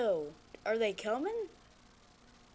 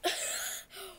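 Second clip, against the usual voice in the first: high nervous laugh; 9 semitones higher, 5 dB quieter than usual. Real, not acted. Laughs after scare.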